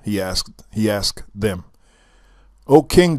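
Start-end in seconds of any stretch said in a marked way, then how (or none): 2.6-3.2 raised, higher, louder cry of praise. Acted. Courtiers hail their king with a loud cry. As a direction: call out to the king in awe. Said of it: "they cried".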